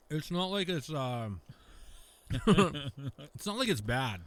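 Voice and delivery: talking around a bite of meat; mouth full. Real, not acted. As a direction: still chewing, words muffled. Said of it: "Can't talk with food in my mouth".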